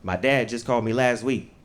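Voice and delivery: usual speaking voice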